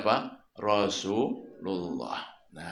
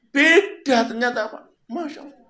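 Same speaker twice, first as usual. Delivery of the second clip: high voiced blind man; 12 semitones higher, 4 dB louder, faster than usual. Voicing the blind man's realisation.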